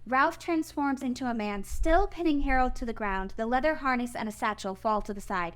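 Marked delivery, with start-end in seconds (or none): none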